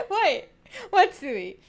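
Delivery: loud, high laughing question; laughing disbelief. Real, not acted. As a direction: burst out, amused and incredulous. Laughing at chat's reaction.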